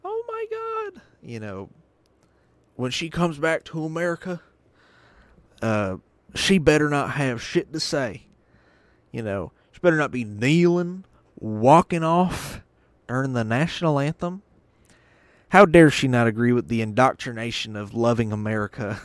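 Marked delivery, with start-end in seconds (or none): 0.0-1.0 squealed high oh my God; high falsetto mock outrage; mock shocked gasp. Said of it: "conservatives are like". 2.8-19.0 indignant mock rant, louder; sneering mock patriot rant; mock indignant conservative. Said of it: "conservatives are like".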